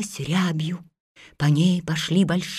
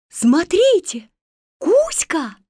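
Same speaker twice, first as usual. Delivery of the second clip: shouting; 8 semitones higher, 3 dB louder than usual. Acted. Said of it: "закричала она".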